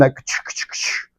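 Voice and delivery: soft breathy ka-choo crack sound; imitated adjustment crack. Doctor imitating the adjustment sound, soft crack. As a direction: mimic the pop of a spinal adjustment. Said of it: "that sound".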